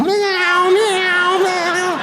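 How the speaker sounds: high mewing meows like a kitten; cat meows. Retold or imitated. Daughter licking strangers' plates, meowing high like a cat. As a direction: meow like a little girl playing cat.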